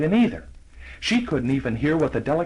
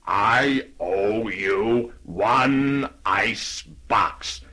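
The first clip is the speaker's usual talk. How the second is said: very slow, lower reading; slow voiced note; 4 semitones lower, much slower than usual. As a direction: spell out the note slowly. Horse writes a note.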